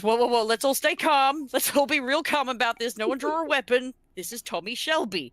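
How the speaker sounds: high, loud, hurried plea to stay calm; retold bystander pleading loudly and high for calm; panicked peacemaker. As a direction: play the nervous guy trying to calm a tense room. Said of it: "someone else comes in and goes".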